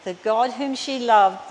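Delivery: normal talking voice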